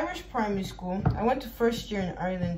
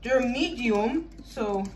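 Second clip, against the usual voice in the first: ordinary talk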